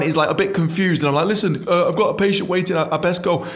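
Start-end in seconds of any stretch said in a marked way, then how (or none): 1.3-3.5 high flustered rush; high-pitched nervous brush-off; nervous excuse. Retold or imitated. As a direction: escape awkwardly. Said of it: "kinda scarper off".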